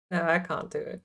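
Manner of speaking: usual speaking voice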